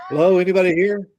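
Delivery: loud, high, quick call into a house. Acted. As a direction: call to see if anyone's home. Calling into a creepy empty house.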